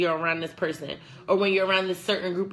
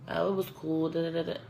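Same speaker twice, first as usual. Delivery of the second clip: low, much softer, lifeless answer; drained partner; 4 semitones lower, 7 dB quieter than usual. Retold or imitated. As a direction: mumble it back as if all energy is gone.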